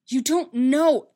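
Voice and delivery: anguished contradiction; upset objection. Acted. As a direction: cut in forcefully. Anakin objecting in the quarrel.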